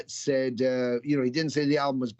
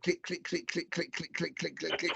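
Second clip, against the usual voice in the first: imitated remote clicks; 3 semitones higher, 8 dB quieter than usual. Daughter flipping TV channels.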